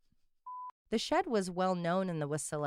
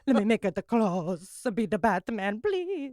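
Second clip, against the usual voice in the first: mock Italian Gucci actor; 2 semitones higher, 5 dB louder than usual. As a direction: ham up the actor's accent. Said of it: "my new favorite noise to make".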